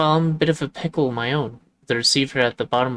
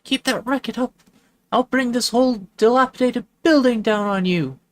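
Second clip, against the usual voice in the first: higher and louder, threatening shout; angry neighbor lady; 12 semitones higher, 5 dB louder than usual. Acted. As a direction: bellow the threat through the wall.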